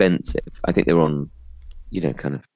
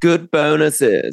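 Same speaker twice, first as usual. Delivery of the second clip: drawn-out echo; 8 semitones higher, much slower than usual. Real, not acted. Repeats friend's phrase drawn out.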